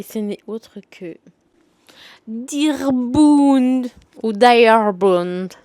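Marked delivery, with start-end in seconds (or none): 2.3-5.5 slow, loud, drawn-out English accent on the title. Shown as a demonstration. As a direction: savour the title in an exaggerated English accent, very slowly. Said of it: "Voilà mon accent".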